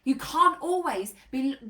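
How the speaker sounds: usual speaking voice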